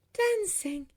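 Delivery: high, astonished echo of "Dancing"; amazed exclamation. A narrator's reading. Girl had never thought of dancing, amazed exclamation. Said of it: "Nessa exclaimed".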